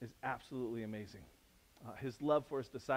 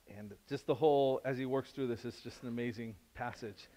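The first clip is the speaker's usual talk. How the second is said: ordinary talk, nothing marked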